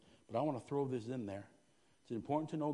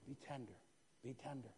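tender hush; 11 dB quieter than usual. Real, not acted. Softened repeated exhortation.

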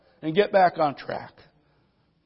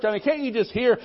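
usual speaking voice

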